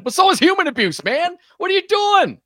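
high, loud outraged yell. Real, not acted. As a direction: shout it in disbelief. Host outraged at abuser.

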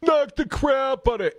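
punched, high-pitched imitation of a rally speaker; Donald Trump. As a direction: put on a rabble-rouser's voice. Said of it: "he told supporters".

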